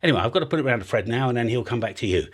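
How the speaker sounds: usual speaking voice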